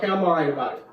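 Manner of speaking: low, soft imitation of someone checking out; conversation-closing dismissal. Friend retells others closing a conversation, low-key dismissal. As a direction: imitate quietly putting a conversation to bed. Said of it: "put a conversation to bed".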